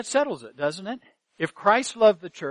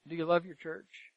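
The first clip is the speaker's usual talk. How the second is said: the same question again, much softer; quieter repeat; 9 dB quieter than usual. Real, not acted. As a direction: drop the voice and ask it once more, gently.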